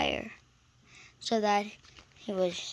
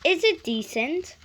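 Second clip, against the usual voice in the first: rising, louder indignant questions; 5 semitones higher, 7 dB louder than usual. A narrator's reading. Gordon appealing to all against Henry, indignant rise. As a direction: demand it with wounded pride. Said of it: "he went on".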